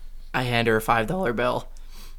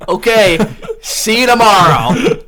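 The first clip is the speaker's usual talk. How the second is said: slow, laughing comeback, higher and louder; 8 semitones higher, 9 dB louder, slower than usual. Retold or imitated. Laughing goodbye to the nosy cashier, higher and louder. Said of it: "And I said".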